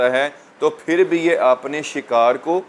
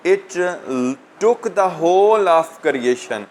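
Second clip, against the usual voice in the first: slow, higher-pitched verse reading; poem recitation; 4 semitones higher, much slower than usual. Real, not acted. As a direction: read out the line slowly, pitched up. Reads poem line aloud to students.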